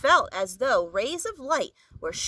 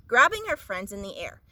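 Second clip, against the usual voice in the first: plain reading voice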